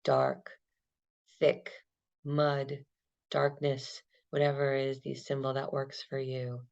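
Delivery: calm, unhurried meditation guide; guided meditation. Acted. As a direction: lead them gently through the breath. Guided body-scan meditation.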